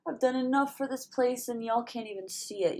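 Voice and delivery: fed up and letting it out; exasperated outburst. Real, not acted. Venting about work.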